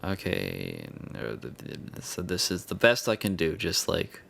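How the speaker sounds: normal talking voice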